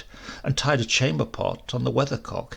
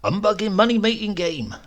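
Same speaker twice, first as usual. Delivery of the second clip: raging darkly; 9 semitones higher, 3 dB louder than usual. Acted. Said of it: "he used to rage darkly".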